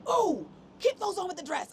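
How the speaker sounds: high excited photographer voice; playful high imitation of the photographer; quoting the photographer. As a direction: mimic his eager direction. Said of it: "and he was like".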